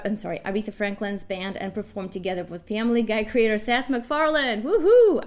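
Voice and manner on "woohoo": loud, high whoop of delight; excited cheer. Real, not acted. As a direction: cheer it out like a fan. Host cheering the guest's credits.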